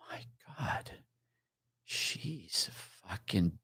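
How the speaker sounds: stunned whisper. Retold or imitated. Cop retells realizing who winked at him, in a slow, stunned whisper. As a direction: barely breathe it out in shock. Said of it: "I'm going".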